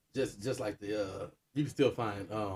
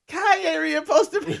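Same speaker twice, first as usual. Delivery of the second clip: high, loud, slow and laughing; laughing mock quote; 11 dB louder, slower than usual. Quoting the comedian's bit, squealing with laughter. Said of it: "she was like".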